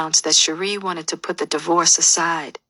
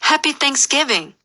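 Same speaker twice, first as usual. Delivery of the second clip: high, bright-pitched holiday wish; mock-jolly, much higher pitch; cheery greeting; 9 semitones higher than usual. A narrator's reading. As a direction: call it out merrily to the whole family. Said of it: "laughing and wishing everyone".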